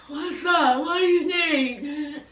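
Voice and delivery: lightly voiced lazy Sean, a bit slow; a hint of a dopey guy; imitating Sean. Sean answers Destiny's call, lightly voiced. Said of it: "He was like".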